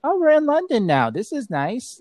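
put-on a delighted six-year-old; child self. Voicing herself as six-year-old.

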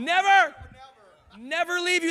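NEVER shouted high and loud; correcting the misquote with a loud Never; stress on never. Real, not acted. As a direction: slam the word Never. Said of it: "the Bible says".

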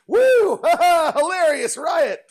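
very high loud mock whoop and laugh; mocking amused wife. Mocking wife's switch to laughing it off, very high whoop. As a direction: ham up her sudden glee. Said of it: "all of a sudden".

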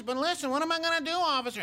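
put-on high, street-tough young Pacino voice. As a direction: pitch up into a young Serpico-era tough guy. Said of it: "kinda like Al Pacino".

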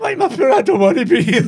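high, loud, laughing wail; mock scared boy. Grown man retells his childhood fright at the horses, laughing. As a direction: act out the terrified wee boy through laughter.

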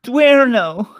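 high, loud mock Italian buongiorno; hammed-up high buongiorno; falsetto Italian greeting. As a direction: chirp it like a puppet. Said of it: "Say buongiorno".

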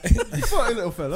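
high, loud laughing British voice; hammed-up British callback; mock British accent. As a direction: tease in a silly British voice.

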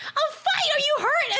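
high-pitched shocked question; alarmed echo. Retold or imitated. Sister on the phone fears she's hurt, alarmed. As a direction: jump high in worried disbelief.

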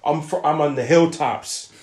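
normal talking voice

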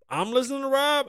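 high, disbelieving question; incredulous. Real, not acted. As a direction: echo it back in disbelief. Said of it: "Who's listening to Rob".

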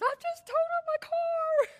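put-on panicked teen son. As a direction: blurt it like a scared teenager. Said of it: "And he goes".

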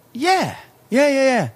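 high, fast mock-eager "yeah yeah"; eager bartender. Mimicking the scheming bartender to friends, high and eager. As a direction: ham up the fake friendliness.

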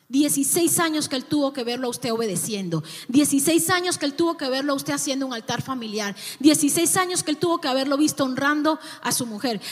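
preaching at full force. Acted. Pastor exhorts parents about their children, full-force preaching. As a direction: preach it out, driving each repeated phrase home.

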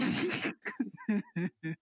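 real laugh breaking off the reading; helpless laugh. Real, not acted. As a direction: laugh in disbelief, can't go on. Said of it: "I can't continue".

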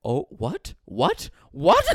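imitating own stunned reaction; mock shock. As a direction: build up the disbelief. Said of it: "open mouth, like".

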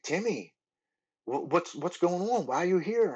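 high-pitched, startled questioning; retold surprise. Friend's father to Timmy, unexpected meeting, retold surprise. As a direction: imitate someone taken aback, voice lifting well up.

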